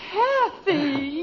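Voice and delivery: crying. Acted. Child pulled out, crying.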